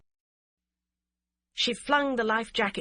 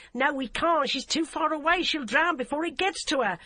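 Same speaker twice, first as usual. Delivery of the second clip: higher, loud hard refusal; raised refusal; 8 semitones higher, 4 dB louder than usual. A narrator's reading. DCI refuses to throw a jacket, higher loud refusal. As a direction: shout her down. Emily refuses over the engine.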